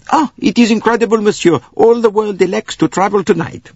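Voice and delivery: put-on high, excited accented official; flustered conductor. A narrator's reading. Conductor tells Bouc of the crowded train, high and flustered. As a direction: exclaim in high amazement.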